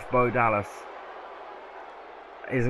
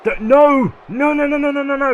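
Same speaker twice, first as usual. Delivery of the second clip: rapid shouted no's, much higher and louder; frantic no's; 12 dB louder than usual. Real, not acted. Commentator losing it at the kickout, frantic shouting. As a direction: scream no over and over in disbelief. Another near-fall kickout.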